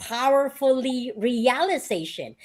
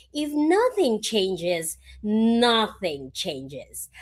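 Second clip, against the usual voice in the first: slow emphatic motto; much slower than usual. Real, not acted. Guest stresses motto slowly.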